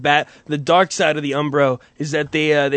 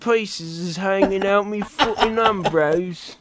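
higher, put-on British accent; mock British accent; 3 semitones higher than usual. A lightly put-on British lad complaining his bits hang out of his Umbros. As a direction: do a cheeky British bloke. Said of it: "I don't do the voices".

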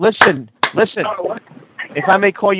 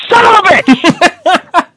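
mock outraged shout; 13 semitones higher, 8 dB louder, much slower than usual. Caller mock-outraged at insult.

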